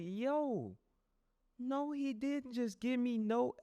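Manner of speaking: high, slow put-on incredulous voice; mock disbelief. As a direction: drag it out in comic disbelief. Retold disbelief at the preacher.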